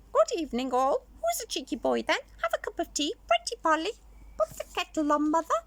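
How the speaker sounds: cheeky parrot. Acted. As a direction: chirp like a talking parrot. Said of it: "what the parrot skeleton was saying".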